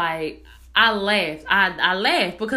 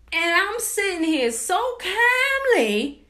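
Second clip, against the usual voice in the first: high, drawn-out protest of staying calm; indignant disbelief; 9 semitones higher, slower than usual. Retold or imitated. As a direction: stretch it high in exasperated amazement. Indignant at being hung up on.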